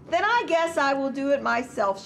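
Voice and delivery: lightly acted exclamation; exclaimed. A narrator's reading. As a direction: exclaim it as the hen, starting high. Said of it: "she exclaimed".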